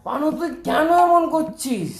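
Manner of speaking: high, loud, drawn-out reproach; exasperated scolding. Acted. Uncle telling off his cook over the guest, exasperated scolding.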